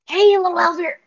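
loud hushed call, high; whisper-shout the line; whisper yelling. Shown as a demonstration. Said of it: "Whisper yell, you said?".

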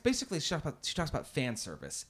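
normal talking voice

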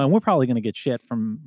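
normal talking voice

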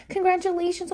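gushing congratulations. Retold or imitated. Repeated gushing.

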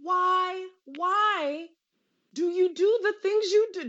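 high, pleading why why why; exasperated pleading at the screen; exasperated plea. Real, not acted. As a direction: beg the character to stop. Scolding TV character.

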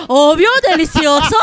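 lightly acted shared delight; mock eager agreement. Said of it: "todas dijeron".

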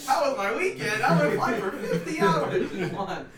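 laughing loud and high. Real, not acted. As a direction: laugh through the words. Laughing about paid flying hours.

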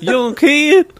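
put-on very high, slow Texan old-timer; hammed-up grandad telling his love story; Texan grandfather. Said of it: "I've got a Texas accent".

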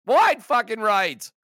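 slow, loud, spitting out each word; bitter fan venting over a missed kick; exasperated outburst. Real, not acted. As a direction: let the frustration burst out. Angry fan repeating the miss.

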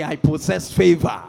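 loud, emphatic declaration; like you mean it. Shown as a demonstration. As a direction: proclaim it forcefully, with full conviction. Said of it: "say it like you mean it".

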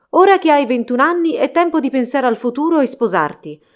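severe. A narrator's reading. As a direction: lay down the law to a son, without affection. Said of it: "Parlava con severità, senza alcun segno di affetto".

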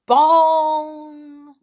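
loud, high ringing bong; clock bell chime. A narrator's reading. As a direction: imitate Big Ben, letting the note ring out. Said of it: "Ben began to chime".

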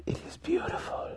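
breathy unvoiced hush, very soft; awed whisper. A narrator's reading. Drizzt watching the sunrise in wonder, whispered. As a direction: dare to speak in wonder. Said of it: "Drizzt dared to remark".

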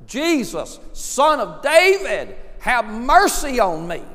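high, loud, drawn-out plea. Retold or imitated. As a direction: cry it out over the crowd. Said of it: "he even cried out louder".

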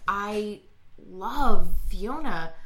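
slow, low, mock-earnest declaration; halting earnest confession. [name] tells Jeff he loves Fiona, halting and earnest. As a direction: draw each word out solemnly.

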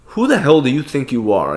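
ordinary delivery

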